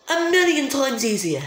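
high, put-on British accent. Gamer cheering an easier game, put-on British accent. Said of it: "I don't know why I talked in British accent".

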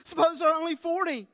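pleading Abraham. Acted. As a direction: press God humbly but urgently. Abraham bargaining with God.

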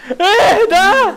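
high, slow terrified wail; frightened shriek. Real, not acted. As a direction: scream it out in fright. Screaming in fright at game. (صرخة رعب)

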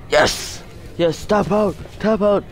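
high-pitched, eager urging; excited cheering. Real, not acted. Cheering a submission in the game.

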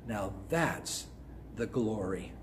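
a soft private murmur of awe; said softly to yourself. Shown as a demonstration. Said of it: "just say to yourself".

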